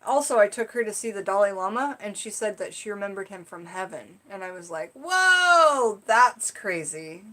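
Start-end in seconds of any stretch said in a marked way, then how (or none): 4.9-7.2 amazed whoa. Retold or imitated. Her reaction to niece's claim.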